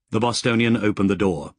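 ordinary delivery, nothing marked

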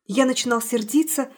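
usual speaking voice

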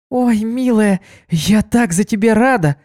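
high delighted gushing; excited cry. Acted. Kuna gushes over Ditsy's news, high and excited. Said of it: "закричала она".

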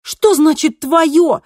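loud, high, indignant protest; near scream, frightened. A narrator's reading. Kristina rejecting being called unneeded, frightened near scream. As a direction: throw the words back, almost screaming. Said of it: "почти закричала Кристина, ... особенно испуганная".

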